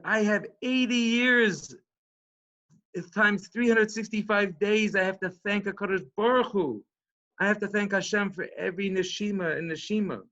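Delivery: high, loud, impassioned imitation of a crying man; retold tearful outpouring. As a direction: draw it out high, imitating an overwhelmed old man. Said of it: "I'm crying because".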